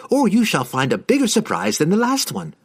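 high, pointed warning; commanding threat. A narrator's reading. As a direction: threaten with a grin. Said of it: "Regis ordered".

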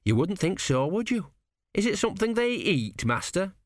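put-on high, wide-eyed young lad; curious apprentice. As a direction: ask with naive curiosity. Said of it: "he said".

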